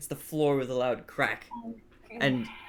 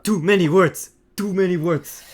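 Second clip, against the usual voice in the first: loud, higher monster voice; confused bugbear; 4 semitones higher, 7 dB louder than usual. Acted. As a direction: complain like a big, baffled beast. Game master voices the confused bugbear.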